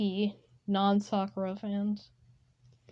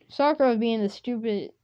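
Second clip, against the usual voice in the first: ordinary delivery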